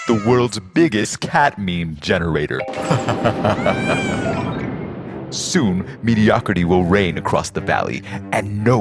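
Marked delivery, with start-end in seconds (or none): 2.6-5.2 evil villain laugh. Acted.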